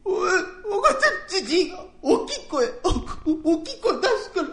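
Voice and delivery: high, halting voice through sobs, stammering; sobbing excuse. Acted. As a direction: blubber the excuse like a scared child.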